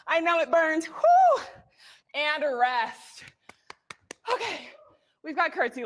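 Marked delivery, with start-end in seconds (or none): 0.9-1.4 high loud woo to fire up the class; a big excited whoop; cheering whoop. Real, not acted. Coach cheering the class through the end of a set, loud whoop.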